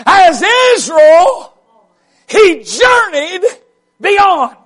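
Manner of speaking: drawn out, rising preacher's call; slow build to the big line; slow preaching build. Acted. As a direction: stretch each word as the point lands.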